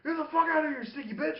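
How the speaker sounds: high-pitched mock angry kick-out; upset outburst. Dad throwing the woman out, upset and high-pitched. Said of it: "really fucking upset".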